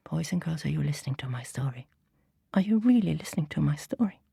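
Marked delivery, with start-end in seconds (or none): 0.1-1.9 whispering. Real, not acted. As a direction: lean in and whisper. Teller whispers to children.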